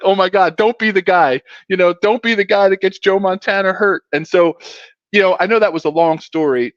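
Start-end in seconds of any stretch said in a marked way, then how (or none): 0.0-4.0 higher-pitched mock panic; retold nervousness. Rookie dreading hurting the star, retold nervousness. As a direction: fret aloud, half-joking. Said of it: "biting my nails".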